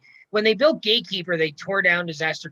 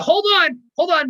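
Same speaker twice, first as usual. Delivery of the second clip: excited interruption; 11 semitones higher, 6 dB louder than usual. Real, not acted. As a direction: hold on blurted out high and loud. Excited interruption to announce agreement.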